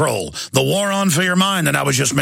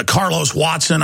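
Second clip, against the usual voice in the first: ordinary delivery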